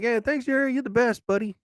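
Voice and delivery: high, cheeky little squirrel thanking a friend; grateful squirrel voice. Retold or imitated. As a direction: pitch it up like a small animal saying thanks. Squirrels thanking him with looks.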